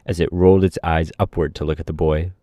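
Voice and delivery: plain reading voice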